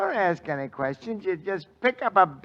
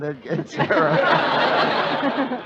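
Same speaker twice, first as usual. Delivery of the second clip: it gets around stretched very slow and louder; drawn-out catchphrase; 7 dB louder, much slower than usual. Acted. Victor milks the joke for the audience, slow and drawn out. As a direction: drag every word out for the laugh.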